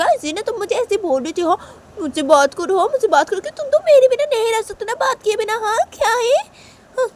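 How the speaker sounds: very high, whiny challenge; acted childish quarrel; indignant squabbling. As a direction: needle your brother in a high, cross voice. Uma argues with Sanju.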